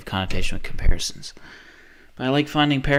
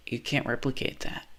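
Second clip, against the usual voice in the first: whisper; 9 dB quieter than usual. Real, not acted. After passionate rant.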